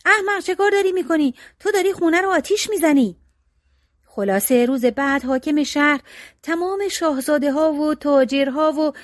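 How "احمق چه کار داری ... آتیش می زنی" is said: higher, scared cry of alarm; startled brothers crying out as the house seems to burn; frightened shout. A narrator's reading. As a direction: yell it in sudden fright at the fire. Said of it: "برادرها ترسیدن و فریاد زدن".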